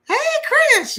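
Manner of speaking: high-pitched Southern grandmother impression; imitating loud grandmother. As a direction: call out in grandma's shrill Southern twang. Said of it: "this high-pitched voice".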